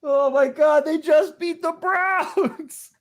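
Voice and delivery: high, slow stunned cry; shocked disbelief. Real, not acted. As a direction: gasp it out in disbelief. Shocked outburst.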